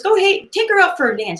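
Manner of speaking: high, pushy buddy urging him on; friend's voice. Retold or imitated. Friend urging Tony to take her dancing, high and pushy. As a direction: egg him on to dance. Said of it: "his friend says".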